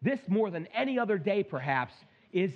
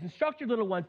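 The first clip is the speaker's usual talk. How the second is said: ordinary talk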